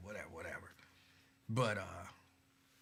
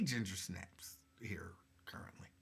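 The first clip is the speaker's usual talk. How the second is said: an offhand whispered aside; 6 semitones lower, 13 dB quieter than usual. Real, not acted. Whispering back to a viewer about ginger snaps. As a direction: drop to a whisper and shrug it off.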